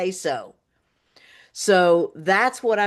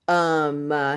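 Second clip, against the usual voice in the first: ordinary talk, nothing marked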